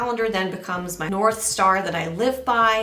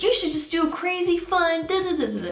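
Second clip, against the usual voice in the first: much higher, bubbly tempting voice, ending in da-da filler; excited inner voice; 10 semitones higher than usual. Retold or imitated. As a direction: egg yourself on like a giddy inner voice.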